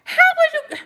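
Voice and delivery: very high disbelieving squeal; incredulous squeak. Retold or imitated. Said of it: "I'm like".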